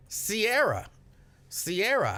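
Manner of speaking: ordinary talk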